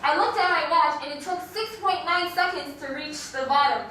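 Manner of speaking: ordinary delivery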